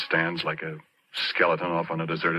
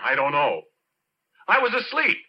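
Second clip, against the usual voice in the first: high, louder, exasperated protest; heated protest; 9 semitones higher, 4 dB louder than usual. Acted. Porky exasperated with the questioning, loud. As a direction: throw the answer back at them.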